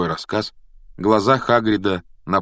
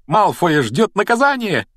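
high, gleeful exclamation; joyful cry; 11 semitones higher, 4 dB louder than usual. A narrator's reading. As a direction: cry it out in delight, pitch soaring. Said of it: "радостно выкрикнула она". (радостный выкрик высоким голосом)

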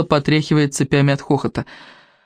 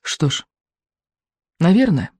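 put-on mildly deflated boy giving up; very weary. A narrator's reading. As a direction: let each phrase out tiredly. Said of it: "произнёс он медленно и очень-очень устало".

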